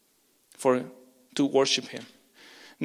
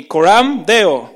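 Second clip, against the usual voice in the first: "Coram Deo" loud and slow, every syllable spelled out; call to repeat; 3 semitones higher, 11 dB louder, much slower than usual. Shown as a demonstration. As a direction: call it out slowly for the congregation to repeat. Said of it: "How many of you can say with me".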